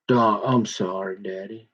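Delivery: put-on sarcastic little-girl apology, dropping low and very soft; trailing mock sorry, almost swallowed. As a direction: mimic her fake sorry with a sneer. Mocking his daughter's apology.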